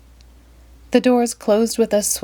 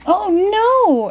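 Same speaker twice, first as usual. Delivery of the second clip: very high dismayed oh no; everyone cries; 13 semitones higher, 4 dB louder than usual. Acted. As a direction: cry out in dismay. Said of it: "everyone cried".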